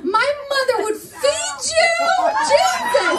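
high, excited outburst; thrilled squeal building to a shout; gushing. Real, not acted. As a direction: exclaim it high, carried away. Gushing at a good-looking audience member.

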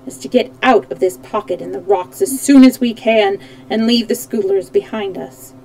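plain reading voice

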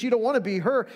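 ordinary talk

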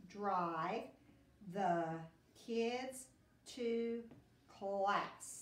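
each word said on its own with pauses; teacher dictating word by word; word-by-word dictation. Shown as a demonstration. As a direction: space out every word for spelling. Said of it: "Keep saying it to yourself".

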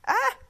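sharp, high startled yell; startled shout. Real, not acted. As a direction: cry out in surprise. Sudden exclamation.

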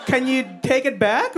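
high, tentative polite plea; mock-polite discomfort. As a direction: imitate someone too nice to complain. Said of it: "too nice to tell me".

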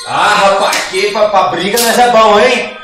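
loud high gloating; excited boasting. Real, not acted. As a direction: brag loudly about the win. Boasting after winning a fight.

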